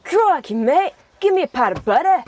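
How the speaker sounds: high, loud hammed-up Australian; Australian accent. Shown as a demonstration. Siblings taking accent requests from viewers, exaggerated Australian. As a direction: lay on a cartoonish Australian accent. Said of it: "the Australian accent".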